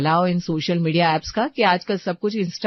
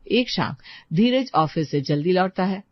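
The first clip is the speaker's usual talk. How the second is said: ordinary talk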